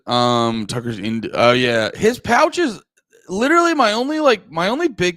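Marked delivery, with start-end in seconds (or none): 2.0-5.2 exasperated. Real, not acted. Ranting about Tucker's Zyn habit.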